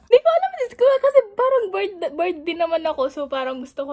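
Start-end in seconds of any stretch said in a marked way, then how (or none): none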